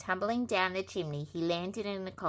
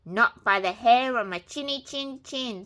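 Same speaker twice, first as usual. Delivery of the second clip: higher and louder little pig refusing; squealing pig; 5 semitones higher, 7 dB louder than usual. A narrator's reading. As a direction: squeal it defiantly like a small pig. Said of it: "squealed the little pig".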